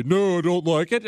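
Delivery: a put-on voice for a little dog refusing. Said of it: "one of the dogs gonna say".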